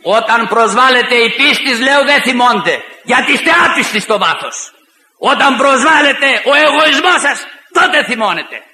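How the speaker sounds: loud, high-pitched accusation; heated rebuke. Retold or imitated. Preacher confronting opponents over faith and wounded egotism, heated rebuke.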